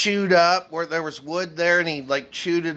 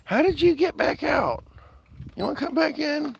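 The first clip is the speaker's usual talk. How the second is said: high sing-song voice for a pet; pet talk; 11 semitones higher than usual. Real, not acted. Talking to a pet.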